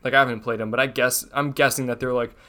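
ordinary talk

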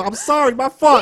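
ordinary talk

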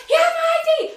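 very high, loud retold shout; calling after them. Woman yells as police drive off with ID, outraged shout. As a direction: yell it after a departing car.

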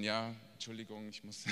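low, very soft excuse; meek apology. Acted. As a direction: apologise timidly, fading away. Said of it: "Und du sagst dann". (kleinlaute, leise Entschuldigung)

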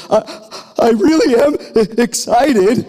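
high, choked sob-voiced words, half laughing; mock sobbing. Groom to his new bride in the car, imitating a sobbing voice. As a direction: re-enact blubbering a promise through tears. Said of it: "But I'm sobbing".

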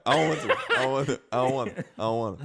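loud playful protest, easing off with each repeat; mock sulky refusal. Co-host refusing his teased sign-off, mock sulky. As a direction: refuse like a pretend-offended kid, half laughing.

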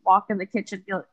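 normal talking voice

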